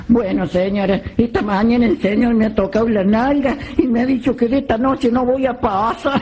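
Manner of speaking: a lightly voiced innocent maid, slowing to a yelp; naive maid. Maid's punchline in the joke.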